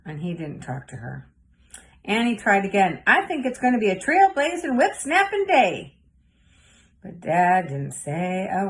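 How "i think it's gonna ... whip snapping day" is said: put-on much higher, eager child's voice; hopeful girl. A narrator's reading. Girl trying again to get her busy dad's attention, high and eager. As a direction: try to win Dad's attention. Annie tries again with Dad.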